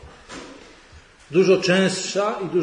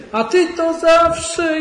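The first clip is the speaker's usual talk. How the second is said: much higher, louder, slow drawn-out reproach; scolding wife; 14 semitones higher, 6 dB louder, much slower than usual. Acted. Wife scolding husband who forgot the onion, high drawn-out reproach. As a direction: stretch out the accusation like a fed-up wife.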